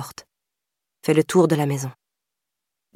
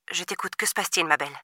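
much softer, gentle reassurance; 8 dB quieter, faster than usual. A narrator's reading. Operator calming the frightened girl, soft and tender. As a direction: soothe a scared child on the line. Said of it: "d'une voix plus douce".

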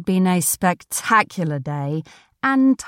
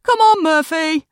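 high, raised call to a dog; calling her dog; 10 semitones higher, 5 dB louder than usual. Acted. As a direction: call the dog along, pitched right up. Woman calls her dog away.

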